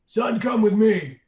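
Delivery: slow, loud, commanding mock officer; slow booming command voice; stern officer. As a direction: play a gruff security chief ordering him. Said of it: "comes walking over to me and goes".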